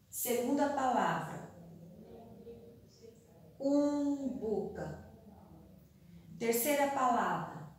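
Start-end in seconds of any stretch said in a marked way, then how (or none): 3.6-5.1 "cumbuca" slowly, first syllable held long; slow dictation. Shown as a demonstration. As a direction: draw out cum in "cumbuca" as you write. Teacher dictates word while writing. ("cumbuca" ditado devagar, cum alongado)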